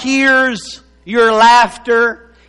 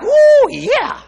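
high-pitched gleeful "woo"; excited whoop; 13 semitones higher than usual. Acted. Abraham hearing he'll have the time of his life, whooping. Abraham told of good times.

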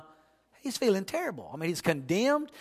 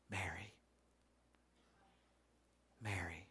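much softer, low, almost whispered name; tender call; 12 semitones lower, 24 dB quieter than usual. Acted. Risen Jesus softly calling weeping Mary by name. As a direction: call her name gently, barely above a breath.

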